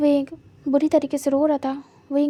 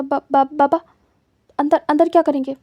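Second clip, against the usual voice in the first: louder, stumbling nervous stammer; panicked stammer; 7 dB louder than usual. A narrator's reading. Wang Ji stalling his father at the door, stammering in panic. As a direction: caught out, trip over the words.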